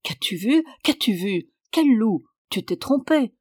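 lightly voiced puzzled peasant; questioning peasant. A narrator's reading. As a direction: question the scared child, then dismiss it. Peasant questions a frightened child.

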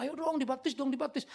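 high, nagging mock plea; pushy pleading. Preacher mimics believers pushing baptism, mock pleading. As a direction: imitate someone pestering to baptize.

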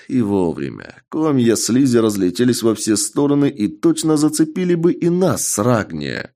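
plain reading voice

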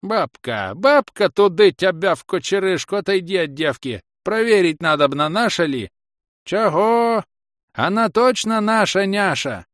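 high, loud rustic wailing; old man hollering. A narrator's reading. Old man calling his wife away from the girl, hollering. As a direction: holler in alarm as an old villager. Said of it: "заголосил".

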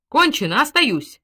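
forceful, high exclamation; angry declaration. A narrator's reading. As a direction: announce it as settled, with temper. Woman angrily declares she is staying.

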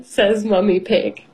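plain reading voice

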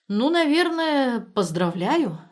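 slow, high, unsure congratulation; hesitant sigh. A narrator's reading. Friend congratulates the new father, sighing and doubtful. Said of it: "Борька вздохнул".

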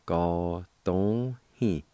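"ga dong he" drawn out slowly; "ga dong he" said very slowly and deliberately; slow careful syllables. Shown as a demonstration. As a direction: stretch out "ga dong he" for learners. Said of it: "Second part".

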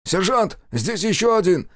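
high, loud alarmed call; shouting soldier. A narrator's reading. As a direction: call out to the sergeant. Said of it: "заорал вдруг боец".

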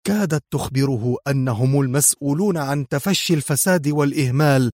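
plain reading voice

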